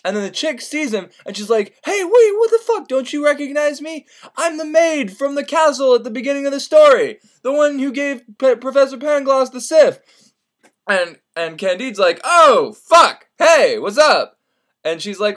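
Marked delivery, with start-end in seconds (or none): 1.8-10.0 high-pitched woman's role, startled; indignant maid. Retold or imitated. Maid asks Candide to recognize her, high and indignant. 12.2-14.4 loud, high realization into a drawn-out greeting; startled recognition. Retold or imitated. Candide recognizes the maid, loudly startled.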